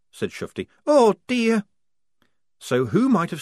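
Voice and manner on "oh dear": high, loud little cry of dismay; high dismayed girl. A narrator's reading. Shufti reacting to the news, high and upset. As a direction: squeak out the dismay.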